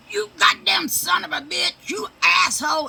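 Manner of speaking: put-on high, loud kid yelling the cusses back; little boy screaming swear words; child parroting cusses. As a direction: shout it like a toddler copying grandma. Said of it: "Max starts repeating".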